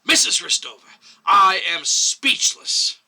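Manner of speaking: loud, slow outburst; exclaimed. A narrator's reading. Chairman bangs the table at the medic, loud exclaim. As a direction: slam it out in disbelief. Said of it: "banged his fist on the table and exclaimed".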